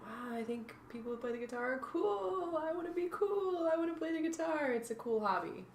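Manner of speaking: mock her old, starry-eyed thinking; light send-up of her younger self; naive younger self. Voicing her younger self's thoughts.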